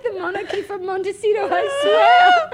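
breaking into high laughter mid-vow; high, loud laughing promise. Acted. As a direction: squeal the oath through giggles.

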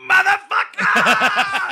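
long, drawn-out high scream; full-throated, over-the-top movie scream. Retold or imitated. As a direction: imitate an action hero bellowing in agony and rage. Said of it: "just starts screaming".

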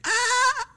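very high yell; mock crowd shout. Class heard through the closing door, high yell. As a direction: squeal like a laughing crowd.

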